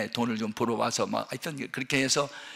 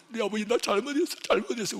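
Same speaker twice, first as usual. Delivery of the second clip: higher, pleading voice saying sorry; tearful confession; 8 semitones higher than usual. Retold or imitated. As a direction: beg the Father's forgiveness, half in tears. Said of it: "그냥 한참 울다가". (울면서 용서를 비는 높은 목소리)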